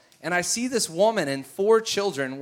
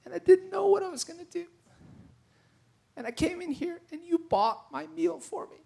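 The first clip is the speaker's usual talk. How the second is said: high, halting tearful woman; tearful retelling; 12 semitones higher, 6 dB quieter than usual. Retold or imitated. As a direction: imitate her choking up, high and strained. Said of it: "starts losing it".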